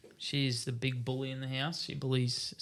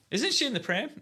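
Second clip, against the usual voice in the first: surprised question; 10 semitones higher, 4 dB louder than usual. Retold or imitated. Dad's panicked question.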